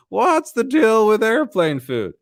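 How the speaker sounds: full-on high, drawn-out comic impression; Jerry Seinfeld. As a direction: stretch every word high, like an observational comic. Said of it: "I love doing that voice".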